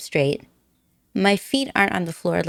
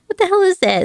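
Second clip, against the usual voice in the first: much higher, louder baffled question; hammed-up bewilderment; mock baffled; 11 semitones higher, 5 dB louder than usual. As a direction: act out the confusion. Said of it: "It's like".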